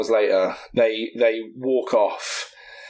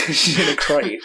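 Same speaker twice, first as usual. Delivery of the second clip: quoting the friend; 4 semitones higher than usual. Retold or imitated. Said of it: "And he's like".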